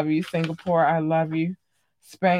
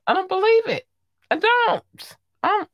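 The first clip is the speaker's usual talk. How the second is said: high-pitched disbelief. Real, not acted. Disbelief about the baby rumor.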